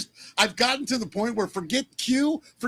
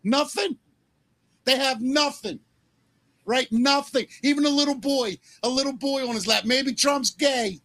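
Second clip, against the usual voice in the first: heated rant; 5 semitones higher than usual. Real, not acted. Heated rant about accusations.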